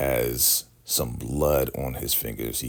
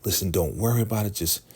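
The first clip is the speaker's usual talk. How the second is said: high-pitched, hurried urging; mock urgent pep talk; 6 semitones higher, faster than usual. Ruth telling Lacey to keep acting, urgent high voice. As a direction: urge her on in a raised imitated voice.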